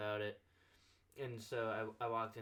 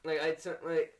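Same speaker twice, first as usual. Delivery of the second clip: excited; 6 semitones higher, 8 dB louder than usual. Real, not acted. Excited insistence, higher and louder.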